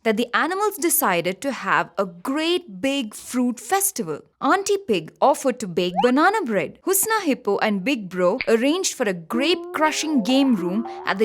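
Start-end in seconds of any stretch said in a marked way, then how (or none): none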